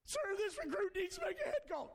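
high, far-off recruit asking permission; squeaky nervous recruit. Retold or imitated. Drill instructor scene, recruit voice.

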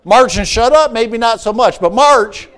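loud, high, driving sermon delivery; preaching at full force. Acted.

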